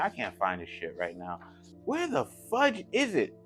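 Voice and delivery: normal talking voice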